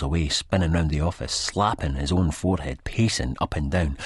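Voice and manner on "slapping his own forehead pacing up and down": usual speaking voice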